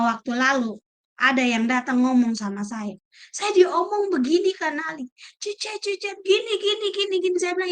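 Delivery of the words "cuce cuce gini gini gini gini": higher, mocking imitation of gossiping tongues; mock gossip chatter. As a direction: lightly mimic a gossip's babble. (tiruan orang bergosip, nada tinggi mengejek)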